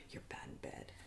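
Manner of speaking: hushed, breathy "bad in bed"; whispered. Rehearsing a breakup line, in a whisper. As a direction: whisper the big one like a secret. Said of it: "pull out the big one".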